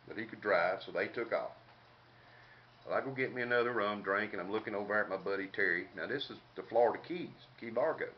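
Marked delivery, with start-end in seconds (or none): none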